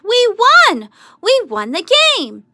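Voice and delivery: very high, loud victory cry; excited yell. A narrator's reading. Tom yelling the win to the team, high and loud. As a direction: cheer the win at full voice. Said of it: "Tom yelled".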